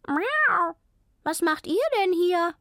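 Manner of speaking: high-pitched, surprised cat; calling cat. A narrator's reading. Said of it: "rief sie". (hoch rufende Katze)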